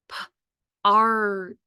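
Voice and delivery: P-art as a separate first sound, then long "ar" and final "t". Shown as a demonstration. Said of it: "Your second word is".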